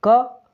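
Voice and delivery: क as a short, high syllable; teacher sounding out short क; pronunciation demo. Shown as a demonstration. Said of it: "इसका उच्चारण".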